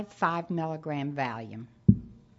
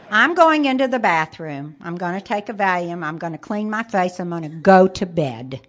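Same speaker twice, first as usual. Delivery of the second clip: retold announcement; 7 dB louder than usual. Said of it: "this is what I said".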